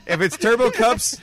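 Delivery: loud, high call of Turbo Cups; excited shout. Real, not acted. Shouting name, then sung.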